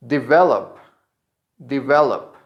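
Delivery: "Develop" stressed on VE, di-VEL-up. Shown as a demonstration. Teacher models verb number two, "Develop" stressed on VE. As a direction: punch the middle syllable of "Develop". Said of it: "the stress is in ve".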